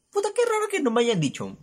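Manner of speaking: squeaky high thought, puzzled; high mock inner voice. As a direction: voice your confused thought up high.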